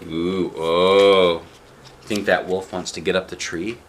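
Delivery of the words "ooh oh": ordinary delivery, nothing marked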